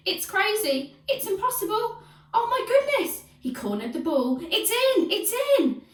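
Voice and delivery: breathless match commentator, leaping high on the big moments; excited football commentary. Acted. Commentator calls Hotfoot Henry's winning goal, excited. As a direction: build to a high, excited cry.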